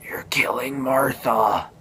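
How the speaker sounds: drawn-out, strained whispery plea; Superman pleading. Retold or imitated. Superman down under the spear, strained plea. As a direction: imitate a slow gasp from a beaten man. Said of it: "Superman's there and he's like".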